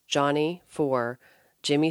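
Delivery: ordinary delivery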